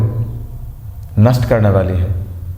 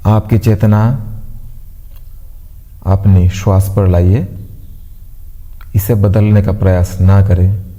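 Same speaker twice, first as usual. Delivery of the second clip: calm, evenly paced meditation voice with long pauses; guided meditation; 3 semitones lower than usual. Acted. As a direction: guide them gently into stillness, pausing between instructions. (शांत, ठहराव भरी ध्यान कराने वाली आवाज़)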